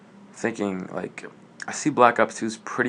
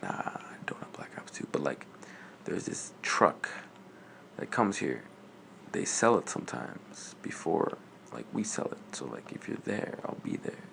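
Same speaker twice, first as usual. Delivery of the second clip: very soft, half-whispered imitation; low-volume, confidential mock dealer; hushed retold tip; 4 semitones lower, 10 dB quieter than usual. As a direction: pass on a tip under the breath. Retold tip about truck sales.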